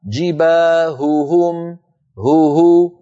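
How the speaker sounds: very slow "جباههم", both ه sounded apart; two audible ه. Shown as a demonstration. Teacher asking if both sounds are heard, exaggerated articulation. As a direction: stretch "جباههم", isolating "هو هو". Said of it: "هل سمعتم الهاءين؟".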